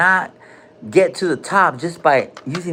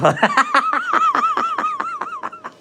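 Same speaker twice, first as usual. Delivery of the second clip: high squealing laugh. Real, not acted. Laughs at fart joke.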